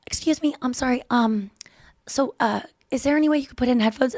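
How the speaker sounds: timid excuse-me voice; polite, apologetic request. Retold or imitated. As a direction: tentatively interrupt a stranger to ask a favor. Voices Anya approaching the loud woman.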